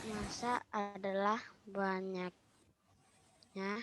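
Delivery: very faint, distant voice; speaking much too softly; barely audible. Real, not acted. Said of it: "Aduh, suaranya terlalu kecil". (suara terlalu kecil)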